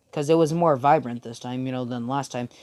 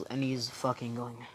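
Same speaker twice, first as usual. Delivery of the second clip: plain reading voice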